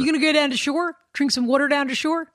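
put-on tossing off a Philadelphia accent for fun; Philly accent. Playfully showing the Philly accent to her cohost. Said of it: "You know I like to do accents".

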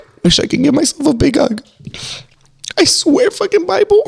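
high, choked-up voice cracking; voice breaking. Real, not acted. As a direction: fight back tears as it climbs. Emotional moment about hugs, near tears.

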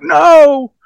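a sudden high, loud wail of loss; anguished cry. Retold or imitated. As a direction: cry out as the cash flies off. Despair as money blows away.

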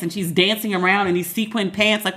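ordinary talk, nothing marked

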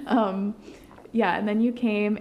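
ordinary delivery

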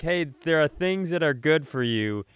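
ordinary talk

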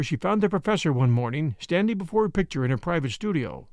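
ordinary delivery

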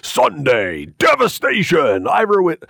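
booming slow announcer voice, high and drawn out; monster truck ad. Shown as a demonstration. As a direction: hype it like a monster truck commercial. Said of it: "the voice of the guy who does those monster truck commercials".

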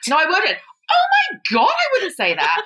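high shriek of outrage; shocked denial. Real, not acted. Woman shrieking at her cohost's accusation, outraged and laughing. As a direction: squeal in mock horror.

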